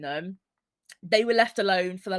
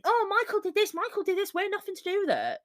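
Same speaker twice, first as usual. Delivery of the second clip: high, fast whiny mockery of their denial; mocking whine; 11 semitones higher, faster than usual. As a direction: sneer it out like a guilty kid pleading innocence.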